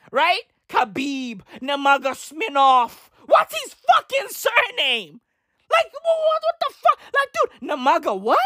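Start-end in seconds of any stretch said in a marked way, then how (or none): 3.3-8.4 high, incredulous outburst at the surname; mock bafflement. Real, not acted. Stumbling over the fighter's surname, incredulous. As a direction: act stunned by the name.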